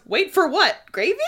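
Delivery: lightly voiced cheeky Cassian; playful Cassian. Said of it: "I just love that Cassian goes".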